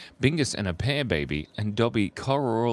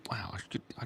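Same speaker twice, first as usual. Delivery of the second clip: whisper; 3 semitones higher, 11 dB quieter, much faster than usual. Real, not acted. Mocking own bad whale drawing.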